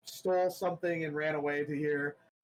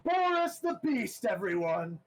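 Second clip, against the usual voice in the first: announcer shout; 6 semitones higher than usual. Acted. Announcer calling Boris out to the crowd. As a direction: sell the name to the stadium. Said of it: "and he goes".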